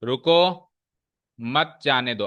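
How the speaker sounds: रुको alone, a clear gap, then मत जाने दो; pause after रुको. Shown as a demonstration. Teacher repeats the sentence for the class, pausing after रुको. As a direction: stop on रुको, then run मत जाने दो as one phrase. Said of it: "pause रुको पे मारा".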